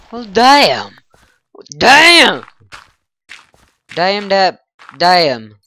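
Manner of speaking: loud, high shouts of damn; furious shouts. Real, not acted. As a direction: yell it out in real frustration. Creeper blew up his stuff.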